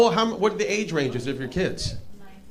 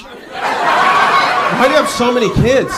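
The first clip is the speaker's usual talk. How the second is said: mock disbelief; 5 semitones higher, 6 dB louder, slower than usual. Real, not acted. Roasting an audience member.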